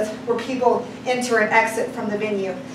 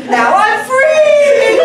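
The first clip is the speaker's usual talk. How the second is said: high, loud, slow proclamation; defiant shout at the cop, high and loud and slow; triumphant shout; 8 semitones higher, 8 dB louder, much slower than usual. Retold or imitated. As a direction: shout it out as a declaration.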